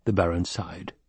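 plain reading voice

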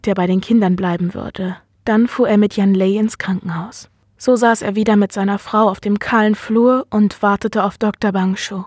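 ordinary delivery

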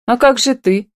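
high-pitched astonished question; lifted, wondering concern; amazed. A narrator's reading. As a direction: ask in sudden surprise. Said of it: "изумилась Камила".

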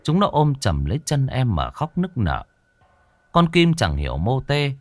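plain reading voice

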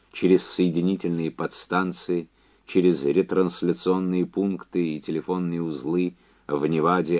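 ordinary delivery

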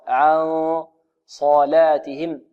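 slow "عن صلاتهم", the n held heavy into the Sad; nasal n before Sad. Shown as a demonstration. Teacher showing how the Noon is affected, slow held nasal. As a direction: hold the hum of "عن" into a heavy S. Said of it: "وبعدها النون تتأثر".

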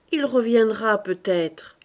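ordinary delivery, nothing marked

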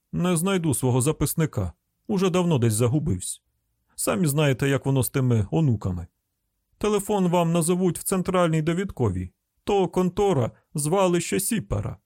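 grumbling hillbilly car owner; rustic householder. Acted.

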